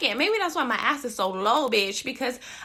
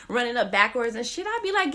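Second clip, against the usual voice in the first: normal talking voice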